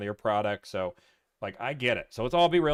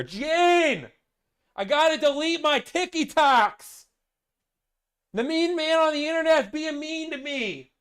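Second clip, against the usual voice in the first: put-on whiny old man; 5 dB louder, slower than usual. Mock salesman calling Gene.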